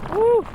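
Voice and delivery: high loud whoop; a wild thrilled whoop; excited whoop. Real, not acted. Whooping in hurricane wind.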